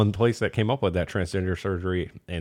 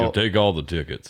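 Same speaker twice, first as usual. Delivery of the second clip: Sam Elliott impression; 4 semitones lower than usual. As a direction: do a deep western cowboy voice. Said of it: "It's like Sam Elliott".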